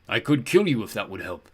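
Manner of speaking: giant's voice making a mild offer; booming, not unfriendly. A narrator's reading. Giant offers to kill the travellers, booming but friendly. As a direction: boom the threat out as a friendly offer. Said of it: "his voice boomed, not in an unfriendly way".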